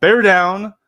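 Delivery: loud, high rally yell; frat bro. Pretend. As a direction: shout the team slogan like a hyped fan. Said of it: "hit 'em with it".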